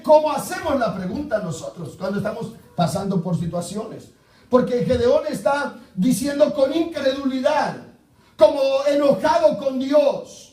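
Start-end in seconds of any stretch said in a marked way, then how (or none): 4.5-10.4 loud, fervent preaching. Acted. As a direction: drive the sermon home with force. Preaching at full force.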